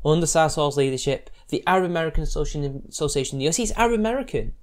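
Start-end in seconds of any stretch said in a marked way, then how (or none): none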